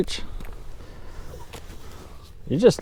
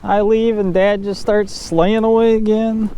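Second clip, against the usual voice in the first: calling out; 10 semitones higher, 4 dB louder than usual. Real, not acted. Loud teasing call to dad.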